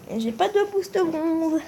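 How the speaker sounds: high, drawn-out mock complaint; playful mock lament. Mock lament over missing boosts.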